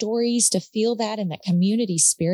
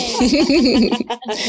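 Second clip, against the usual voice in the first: kind gentle laugh; 3 semitones higher, 7 dB louder than usual. Real, not acted. Warm thanks exchanged between hosts.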